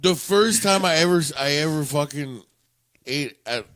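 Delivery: ordinary talk, nothing marked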